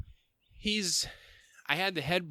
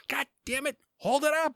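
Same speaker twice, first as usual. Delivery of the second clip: high, loud cursing yell; yelling at son; 5 dB louder than usual. Retold or imitated. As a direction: curse and shout the order in one hot breath. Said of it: "I said".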